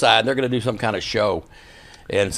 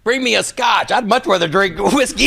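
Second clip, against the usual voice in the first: high, raised-voice bravado; retold blustering order; 11 semitones higher, 4 dB louder than usual. Storyteller imitates Harley outdoing his drink order, blustering. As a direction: order it big, as the punchline.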